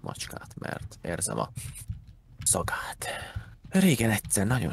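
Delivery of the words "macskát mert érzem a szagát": whisper. Real, not acted. Joking about smelling cats.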